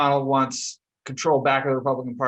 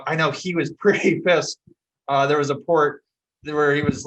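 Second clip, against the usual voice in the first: normal talking voice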